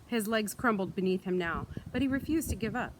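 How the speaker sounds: plain reading voice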